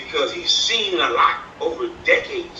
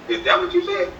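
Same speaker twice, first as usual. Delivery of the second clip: mocking. Mocking rival's claim.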